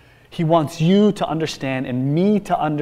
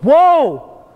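"Woe!" burst out high and loud, held long; explosion of anger; 12 semitones higher, 8 dB louder than usual. Shown as a demonstration. Preacher showing how Jesus cried woe, explosive. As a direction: shout "Woe!" as a startling angry cry. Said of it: "supposed to leave you startled".

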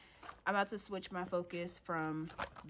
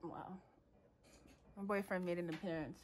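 ordinary delivery

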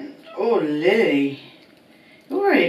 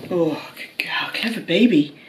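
whispered praise; 2 semitones lower than usual. Real, not acted. Owner whispering praise to her good dog. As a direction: breathe the praise gently to the dog.

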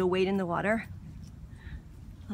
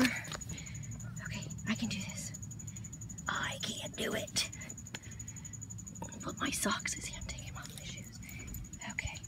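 hushed, breathy self-talk; nervous whisper; 7 dB quieter than usual. Real, not acted. Woman about to wade into the water whispers to herself. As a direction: whisper to psych yourself up.

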